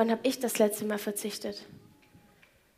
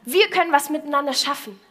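rallying call; 3 semitones higher, 6 dB louder than usual. Pretend. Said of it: "geht zu diesen Menschen hin und sagt".

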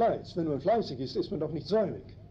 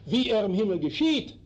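louder, with the word "geschieht" punched and rising; stress on geschieht; 3 semitones higher, 4 dB louder than usual. Shown as a demonstration. Preacher answers his own grammar question, stress on "geschieht". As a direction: land hard on "geschieht" as the answer. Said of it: "Wie soll der Wille auf Erden geschehen?".

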